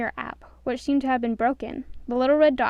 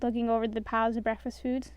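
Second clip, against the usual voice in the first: usual speaking voice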